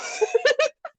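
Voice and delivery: high-pitched burst of laughter; a sudden loud laugh that jumps up high. Real, not acted. Laughs at Eef.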